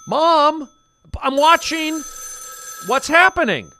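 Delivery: high, loud calling to another room; shouting across the house. Acted. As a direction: yell from the next room. Mom and kid shouting scene.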